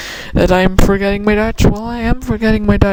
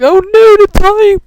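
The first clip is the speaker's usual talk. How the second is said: high, louder mock panic; alarmed exclamation; 12 semitones higher, 5 dB louder than usual. Reliving the end of the last clip, alarmed exclamation. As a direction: exclaim as if suddenly out of time. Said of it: "because I was like".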